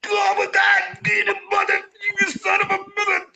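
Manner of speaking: mock furious shout; angry yell. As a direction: rage at the stuck garbage. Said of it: "he just goes".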